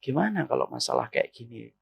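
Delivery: wife softly invites husband to settle a problem, low and gentle. Shown as a demonstration. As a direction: ask him gently, low and soft. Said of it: "Coba, Bu, ngomong kayak gitu".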